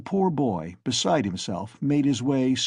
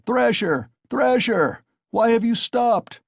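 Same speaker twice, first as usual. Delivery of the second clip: very high, loud jeering cries; crowd shouting; 12 semitones higher, 5 dB louder, slower than usual. A narrator's reading. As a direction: egg him on loudly. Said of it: "shouted voices in the crowd".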